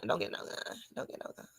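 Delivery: whispered under her breath; whispered take-back. Real, not acted. Taking back the gun joke to viewers, whispered. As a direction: hush it quickly as a retraction. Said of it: "it's a figure of speech".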